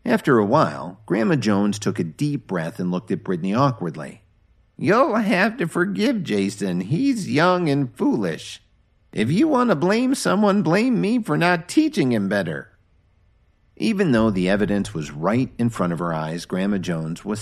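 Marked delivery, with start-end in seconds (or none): 4.8-12.7 apologetic grandmother. Acted. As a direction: excuse the boy, take the blame yourself.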